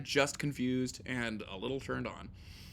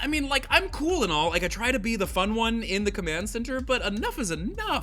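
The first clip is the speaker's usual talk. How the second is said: high, loud, fed-up rant; she's at the end of her rope; exasperated officer; 11 semitones higher, 5 dB louder than usual. Acted. Officer scolds meddling kids, freaking out. Aisha snaps at kids on computer.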